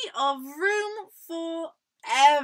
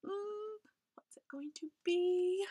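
scared little whisper; 6 semitones higher, 7 dB quieter than usual. Acted. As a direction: whisper it nervously. Nervous whisper.

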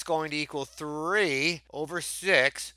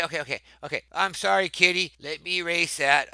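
ordinary talk

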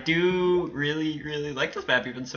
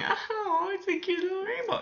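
very high, softer squeal; cutesy cooing; 5 dB quieter than usual. Real, not acted. Gamer distracted by a little rainbow, cutesy cooing. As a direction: coo in a tiny high voice.

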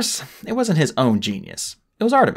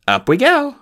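bright call; 13 semitones higher, 6 dB louder than usual. Acted. Holly lifting off.

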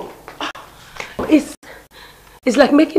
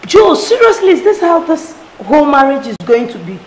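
raised, higher voice in indignant protest; angry quarrel; 4 semitones higher, 6 dB louder than usual. Acted. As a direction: fire back at your fiancé, loud and fed up. Fiancée quarrels over his mother's control.

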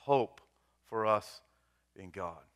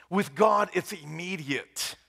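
high, loud sermon proclamation, slowing at the end; full-force preaching; 7 semitones higher, 4 dB louder, slower than usual. Real, not acted. Pastor tells congregation God forgives at once, preaching hard. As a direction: preach it with urgent conviction.

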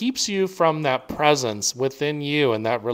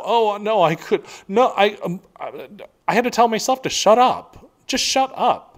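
much higher, mock-flustered refusal of an offer; flustered self-talk; 7 semitones higher than usual. Speaker imitating her old self refusing a friend's offer, high and flustered. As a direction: squeak out the protest, then scold yourself. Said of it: "'Cause I used to do it to myself".

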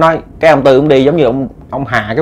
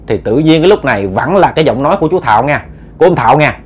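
ordinary delivery

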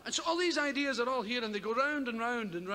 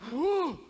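sudden high loud whew; whew noise; 6 semitones higher, 5 dB louder than usual. Retold or imitated. Comedian imitating his odd noise, loud high whew. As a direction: blurt out a startled whew.

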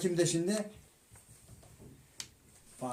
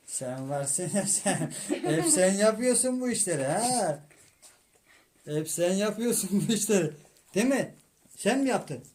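mock accusation; 3 semitones higher than usual.

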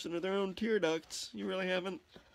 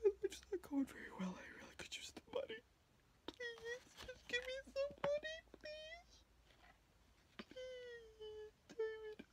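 high, whispered, crying plea for money; acted tearful plea; 13 dB quieter than usual. Begging David for money, through acted tearful whispers. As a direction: beg through fake tears, breathy and high. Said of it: "I actually pulled tears out".

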